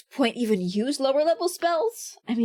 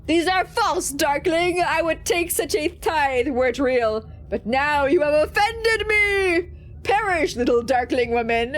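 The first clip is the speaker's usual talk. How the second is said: offended demon; 3 semitones higher than usual. Acted. Demon condemning the darkling woman, wrathful. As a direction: rage at the deception.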